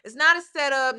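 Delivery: high, loud and insistent; emphatic. Real, not acted. Reactor explaining to viewers, high emphatic insistence. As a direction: hammer the point home to the viewers. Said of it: "For those wondering".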